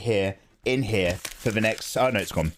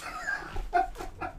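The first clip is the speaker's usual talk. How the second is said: breathless laugh; 8 dB quieter than usual. Real, not acted. Laughing after the scare.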